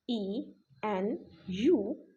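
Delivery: usual speaking voice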